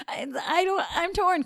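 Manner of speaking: lightly mocking, high-pitched indecision; high voice of a torn friend, lightly put on; imitated waverer. Imitates Nick's torn reply.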